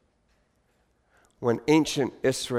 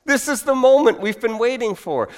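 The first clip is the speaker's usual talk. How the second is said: jubilant higher yes; excited crowd reaction; 8 semitones higher, 4 dB louder than usual. Acted. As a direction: burst out as a thrilled crowd. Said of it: "anticipate in the reaction of the crowd".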